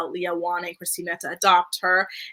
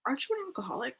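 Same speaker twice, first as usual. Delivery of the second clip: very soft, higher-pitched mock question; mock discreet aside; 5 semitones higher, 11 dB quieter, much faster than usual. Host's pretend aside to the man heading to the bar, hushed mock concern. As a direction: ask it under your breath, pitched up.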